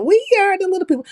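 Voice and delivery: a high, fast mock chorus voice; mock little people. Mocking airport short people in a high quick voice. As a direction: squeak it like the little folk, mildly.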